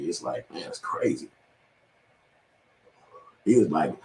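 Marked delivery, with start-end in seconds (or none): 0.1-1.3 hushed, much softer remark; breathless whispered comment; whispered aside. Real, not acted. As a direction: drop to a whisper to yourself. Muttering aside to himself.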